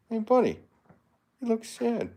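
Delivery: very high-pitched pet voice. Real, not acted. Streamer greeting the sad-looking dog, high baby talk.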